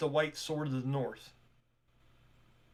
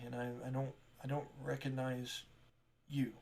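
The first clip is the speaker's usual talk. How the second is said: softer, hesitant apologetic shop clerk; flustered shopkeeper; 2 semitones lower, 8 dB quieter than usual. Acted. Tamara apologizing to strangers, softly flustered. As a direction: stumble politely, a little embarrassed.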